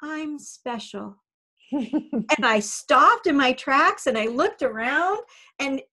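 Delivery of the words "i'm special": soft, slow, faint little voice; barely there, a small plea from the stall. Retold or imitated. Horse calling faintly to passing volunteer, faint and slow. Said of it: "I heard this faint voice say".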